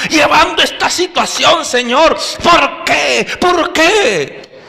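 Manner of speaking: desperate prayer shouted upward; anguished cry to God. Acted. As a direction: cry out to the Lord in despair. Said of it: "y decían".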